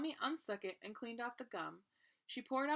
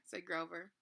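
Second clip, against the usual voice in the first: plain reading voice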